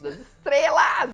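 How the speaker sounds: slow, loud, laughing exclamation; laughing shout. Real, not acted. Bragging to friends about her star hairdresser, laughing shout. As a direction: crow it out with a laugh.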